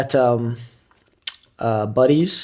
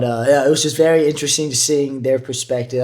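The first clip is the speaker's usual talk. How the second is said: normal talking voice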